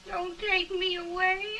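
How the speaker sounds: loud, panicked cry on take. Acted. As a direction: cry out in fear.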